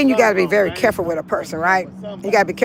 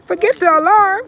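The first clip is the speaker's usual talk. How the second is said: frustrated outburst; 6 semitones higher than usual. Real, not acted. Woman fed up with her spray alarm, exasperated outburst. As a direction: give up on it with a burst of frustration.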